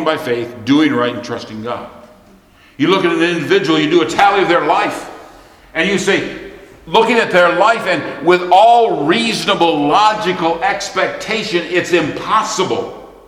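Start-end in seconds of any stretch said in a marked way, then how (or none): 2.8-13.1 high, forceful pulpit delivery; emphatic preaching. Acted. Preacher telling congregation about a hopeless life, high and emphatic. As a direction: build the case hard, then stretch the verdict.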